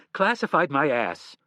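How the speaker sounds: loud, high furious tirade; wife yelling at her husband; angry. Acted. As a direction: let him have it. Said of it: "looked angrily at her husband".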